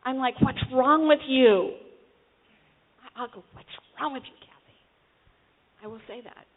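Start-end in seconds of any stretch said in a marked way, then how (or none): 0.4-1.8 loud, slow scolding of oneself; self-berating. Retold or imitated. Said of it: "in a very tearing myself down way". 3.5-4.8 softer self-reproach, under the voice; self-berating. Retold or imitated. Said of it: "in a very tearing myself down way".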